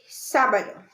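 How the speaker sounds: Sábado stressed on its opening syllable; stress on first A. Shown as a demonstration. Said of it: "stress on the A".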